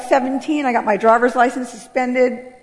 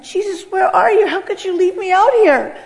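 much higher, pleading cry; retold tearful plea; 7 semitones higher than usual. Homeless girl crying out to Jesus, high and pleading. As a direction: beg Jesus through tears. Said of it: "began to cry my eyes out, saying".

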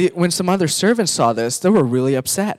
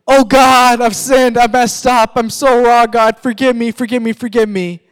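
desperate pleading; 7 semitones higher, 7 dB louder than usual. Retold or imitated. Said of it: "I'll be like".

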